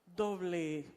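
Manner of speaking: hushed, low-pitched closing prayer; leading prayer. Real, not acted. Leads closing reflection and prayer.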